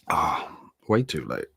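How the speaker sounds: ordinary delivery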